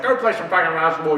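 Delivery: fast, loud imitation scolding; mock angry dad. Dad ordering his son out to play, mock angry. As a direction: rattle off a gruff order as a retold dad.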